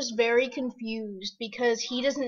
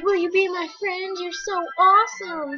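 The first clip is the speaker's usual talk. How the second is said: pitched way up, pleading and gushing; mock fan begging; 7 semitones higher, 4 dB louder than usual. Players begging to be friends, mock high pleading voice. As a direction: beg like an overeager stranger. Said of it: "And everybody's like".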